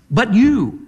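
YOU punched high with a quick fall; strong, emphatic. Shown as a demonstration. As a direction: land hard on YOU in "But you". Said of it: "very strong, emphatic".

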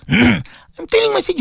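ordinary delivery

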